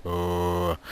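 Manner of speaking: drawn-out undead groan; zombie moan. Pretend. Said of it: "that's my zombie impression".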